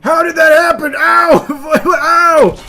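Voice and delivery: very high, loud yelps; frantic high wails of pain; pained screaming. Real, not acted. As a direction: scream in shocked mock agony. Character suddenly hurt in game.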